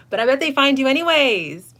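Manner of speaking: high, loud playful tease; a loud high teasing prediction; excited tease. Real, not acted. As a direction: shout it out laughing.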